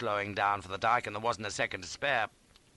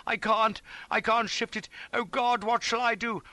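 strained desperate cry; groaning with effort; 11 semitones higher than usual. A narrator's reading. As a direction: wail helplessly while heaving. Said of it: "thrusting at the sled and groaning".

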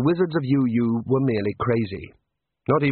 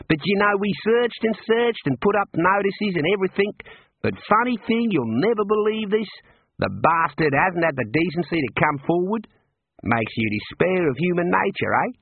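higher, sarcastic jailer voice; mocking warder; 7 semitones higher than usual. A narrator's reading. Warder mocks Rincewind about the missing thief, sarcastic and higher. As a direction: lay the fake sympathy on thick.

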